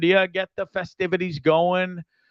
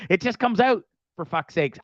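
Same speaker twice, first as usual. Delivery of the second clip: ordinary talk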